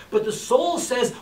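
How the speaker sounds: normal talking voice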